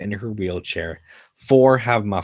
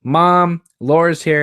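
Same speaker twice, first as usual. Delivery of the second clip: much higher and louder call across the house; yelling to mom; 9 semitones higher, 8 dB louder than usual. Acted. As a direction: shout it to the kitchen. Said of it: "yells to the kitchen".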